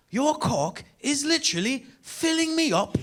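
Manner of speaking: high, slow British woman impression; British accent. London girl to comedian while hooking up, mock British accent. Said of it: "in a British accent".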